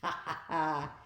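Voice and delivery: gleeful chuckle; triumphant little chuckle; chuckling. A narrator's reading. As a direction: chuckle at their trouble. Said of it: "do the chuckling".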